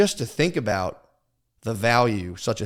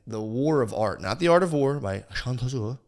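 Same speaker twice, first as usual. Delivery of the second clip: normal talking voice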